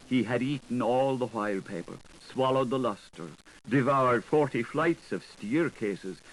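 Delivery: ordinary delivery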